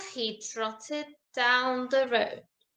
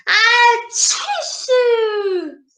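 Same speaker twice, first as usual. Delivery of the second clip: a big hammed-up sneeze, higher; mock loud sneeze; 9 semitones higher, much slower than usual. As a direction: fake a loud sneeze right in his ear.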